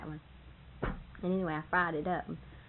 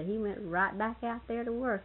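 ordinary talk, nothing marked

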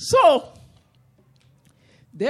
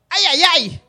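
pain cry title; 4 semitones higher, 9 dB louder than usual. Shown as a demonstration. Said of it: "That story called".

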